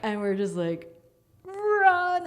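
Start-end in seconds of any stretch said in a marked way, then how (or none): 1.4-2.3 high, loud re-enacted yell; panicked cry to friends; urgent shout. Retold panicked flight from house.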